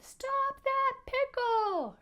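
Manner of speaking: high, loud, drawn-out yell; upset shout. Shown as a demonstration. Mister Adolph gives chase to the pickle, upset high shout. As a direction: shout it after a runaway, very high and stretched. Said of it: "Can you say it like he did?".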